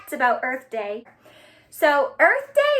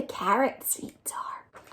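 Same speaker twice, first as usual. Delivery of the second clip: put-on hushed whisper to the children; a soft aside over tiny seeds; 4 semitones lower, 8 dB quieter, slower than usual. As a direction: whisper as if sharing a secret. Showing tiny seeds in picture book.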